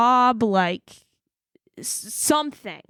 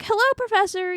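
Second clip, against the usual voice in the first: high, polite phone hello; bright phone greeting; 11 semitones higher, faster than usual. Retold or imitated. Student answering her professor's call, high polite hello. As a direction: put on a sweet polite voice for the professor.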